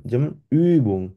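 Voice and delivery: ordinary delivery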